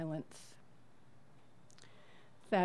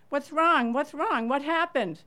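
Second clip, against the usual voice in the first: higher, louder urgent shout of concern; urgent calling; 6 semitones higher, 8 dB louder than usual. Retold or imitated. Neighbor calling to the crying family, urgent loud questions. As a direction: call out what's wrong as you run up. Running toward crying strangers.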